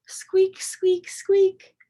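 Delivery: high, loud squeaks; playful squeak noises; squeaky shoe sound. Retold or imitated. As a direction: imitate squelching wet shoes. Imitating wet shoes.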